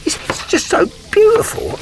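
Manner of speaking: high, hammed-up tearful voice; emotional. Spokesman moved by saving folks money, mock tearful. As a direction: play it choked up, pitch climbing. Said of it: "I just get so emotional".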